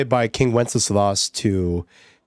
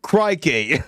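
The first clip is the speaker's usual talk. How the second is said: mock Australian accent; 6 semitones higher than usual. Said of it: "what's things, like, Australians say?".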